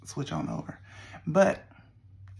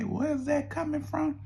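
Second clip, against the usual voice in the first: usual speaking voice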